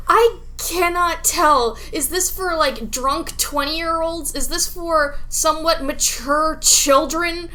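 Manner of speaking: usual speaking voice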